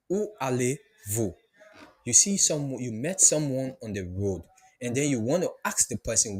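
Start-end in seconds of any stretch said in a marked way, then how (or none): none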